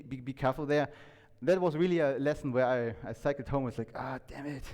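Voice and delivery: ordinary talk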